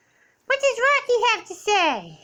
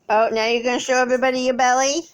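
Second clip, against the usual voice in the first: usual speaking voice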